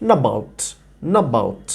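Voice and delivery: "not about" merged into one word, "na-baut"; "not about" run together, the t dropped; linked not about. Shown as a demonstration. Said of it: "দুটোকে একসাথে করে একটা ওয়ার্ডের মতো করে".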